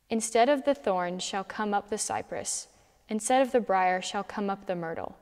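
usual speaking voice

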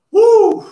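loud high whoop; excited whoop. Real, not acted. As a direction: let out a big whoop. Workout cheer after final rep.

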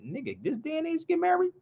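high-pitched disbelieving scoff; mock disbelief at marriage; incredulous. Real, not acted. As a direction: scoff at the very idea of marrying, voice shooting up.

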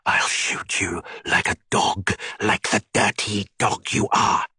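slow whispered threat; deadly whisper. A narrator's reading. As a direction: hiss it out slowly. Jacqueline pulls the gun.

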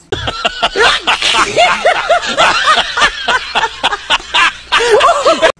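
high, loud burst of laughing; loud laughter. Real, not acted. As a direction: cackle with delight. Triumph entering the hole.

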